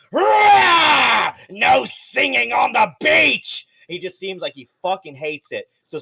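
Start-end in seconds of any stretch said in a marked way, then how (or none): 0.1-3.7 high, loud, slow roared monster line; roaring shark man. Acted. Shark man yelling at the singers on the beach, roaring. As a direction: bellow it like an angry sea brute. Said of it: "as he's like".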